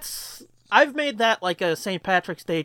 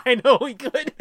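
laughing; 5 semitones higher than usual. Real, not acted. Laughing while agreeing.

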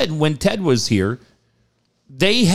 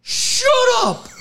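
much higher mock scream; much slower than usual. Hypnotized man screaming at the hypnotist, hammed-up scream. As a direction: shriek it at the host. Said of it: "He's just screaming".

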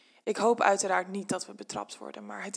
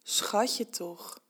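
hoarse voice; 3 dB quieter than usual. Acted. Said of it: "zegt hij met hese stem".

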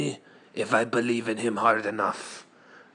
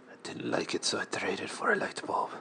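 whisper; 3 semitones lower, 7 dB quieter, faster than usual. Acted. Deadpan whispered aside.

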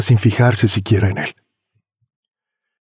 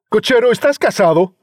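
higher brash question; young man calling; 12 semitones higher than usual. Acted. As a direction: call over to the driver. Youth asks.

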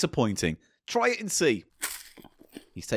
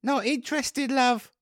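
put-on Essex accent; 11 semitones higher, slower than usual.

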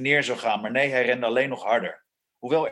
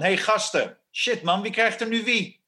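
pitched-up shout to the lads; excited call-out; 8 semitones higher than usual. Acted. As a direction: call out high to your mates. Calling out to arriving mates. (opgewonden roepen naar je maten)

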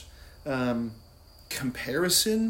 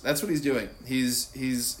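normal talking voice

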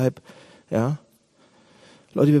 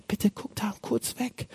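slow, very soft, higher-pitched request; secretive plea; 5 semitones higher, 10 dB quieter, slower than usual. Acted. Acted secretive plea to God. (heimliche, ganz leise Bitte)

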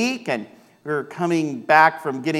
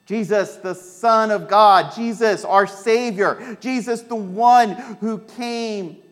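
slow, higher, building litany; preaching cadence; 5 semitones higher, slower than usual. Real, not acted. Pastor proclaiming Jesus to the congregation, slow and building. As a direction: lift each name of Jesus slowly.

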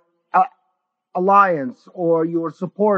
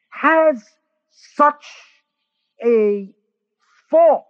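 has, such and false each punched high and loud, with long pauses between; slow hammered emphasis; 5 semitones higher, 4 dB louder, much slower than usual. Real, not acted. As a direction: drive each word home like a preacher. Preacher hammers key words.

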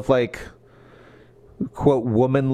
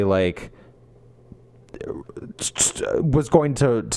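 usual speaking voice

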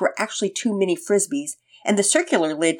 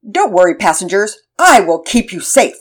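loud, bold hero declaring; heroic superhero voice; 2 semitones higher, 10 dB louder than usual. Acted. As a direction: proclaim it like a brave superhero. Superhero voice in Pearson's play.

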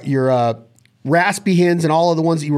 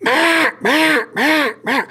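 much higher, duck-like mah sounds pushed from the belly; hen mallard call; 11 semitones higher, slower than usual. Shown as a demonstration. Coach demonstrating a mallard call without a call, high duck voice. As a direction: call like a hen mallard, hot pressurized air. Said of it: "So when I'm walking around, I'm going".

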